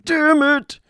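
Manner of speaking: high, slowed whine of disappointment; hammed-up complaint; mock dismayed person. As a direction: pretend to mourn the land masses. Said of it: "No one's like".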